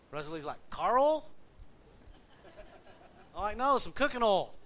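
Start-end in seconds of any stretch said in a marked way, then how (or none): 0.7-1.3 high, loud, rising query; baffled check, pitched way up; puzzled question. Retold or imitated. Retold puzzled question back. 3.6-4.5 high, loud clarifying retort; retold exasperation at being misheard; exasperated correction. Retold insistence after being misheard.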